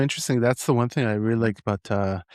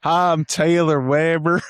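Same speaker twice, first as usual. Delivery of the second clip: slow, loud mock Texas drawl in a higher voice; expected Texas accent; 5 semitones higher, 6 dB louder, slower than usual. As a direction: ham up a Texas accent for a greeting. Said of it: "I thought it was gonna be like".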